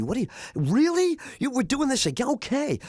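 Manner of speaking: high, breathless squeal of joy; giddy excitement. Retold or imitated. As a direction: bounce off the walls like a kid. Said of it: "got all excited".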